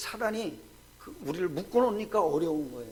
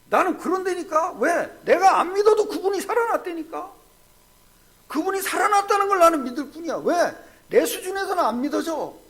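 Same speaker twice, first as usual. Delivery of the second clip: loud, insistent preacher's answer; retold loud retort; 8 semitones higher, 8 dB louder than usual. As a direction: press the man hard. Pastor retelling his loud answer. (큰 소리로 다그치는 목사)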